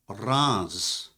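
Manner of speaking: Reims said as one syllable, like "rahnz"; "Reims" as "rahnz", not as spelled; French pronunciation. Shown as a demonstration. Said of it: "the French pronounce it Reims".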